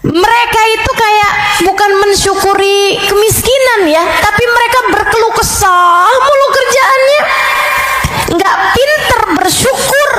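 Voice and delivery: rising indignation. Acted. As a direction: climb in pitch as the reproach builds. Preacher scolding ungrateful poor.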